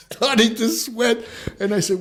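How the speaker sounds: high, laughing through the words. Real, not acted. Retelling the prank on him, laughing high. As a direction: laugh it out, amused.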